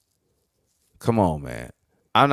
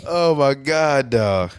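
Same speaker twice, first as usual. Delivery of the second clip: ordinary talk, nothing marked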